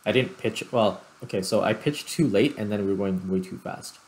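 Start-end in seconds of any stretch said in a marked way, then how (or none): none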